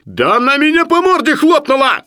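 very high, loud shriek of protest; indignant squeal. A narrator's reading. Sharikov defends biting the lady, squealing. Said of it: "взвизгнул Шариков".

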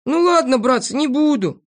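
high-pitched, conceding; Незнайка giving in. A narrator's reading. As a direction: back down in a high voice. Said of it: "согласился Незнайка". (уступая, высоким голосом)

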